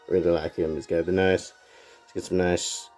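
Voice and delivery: usual speaking voice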